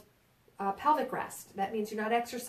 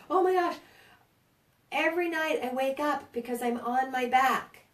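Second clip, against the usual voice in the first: mock complaint; 4 semitones higher, 5 dB louder than usual. Patient complaint voiced.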